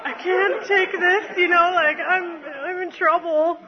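high, pleading plea for mercy; retold desperate plea to mom. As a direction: beg her not to yell. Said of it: "I'm like".

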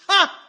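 sharp high loud scoffing laugh; scornful ha. Real, not acted. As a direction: bark one mocking ha.

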